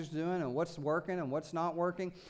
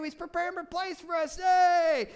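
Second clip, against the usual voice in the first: high, loud gleeful shout; excited shout; 8 semitones higher, 4 dB louder than usual. Acted. Preacher to congregation, shouting for joy at the promise. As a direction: shout it out like a fan.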